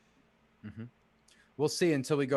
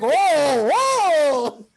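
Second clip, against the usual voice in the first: excited; 12 semitones higher, 12 dB louder than usual. Retold or imitated. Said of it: "he was like".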